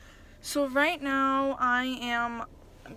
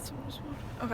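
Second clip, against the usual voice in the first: whispered awe; 9 dB quieter than usual. Real, not acted. Overwhelmed in the car wash.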